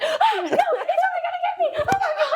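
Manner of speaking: high-pitched retold panic, half laughing; panicked, mock-scared. Storyteller reliving the man running at her car, panicked. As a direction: squeal it in hammed-up fright. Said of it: "I was so scared".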